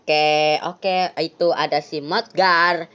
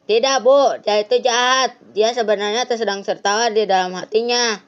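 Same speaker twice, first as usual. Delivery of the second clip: put-on higher, pushy boy accusing his friend; a bratty teen snapping at mom; kid Anton voice; 7 semitones higher, 4 dB louder than usual. (suara anak remaja yang ketus)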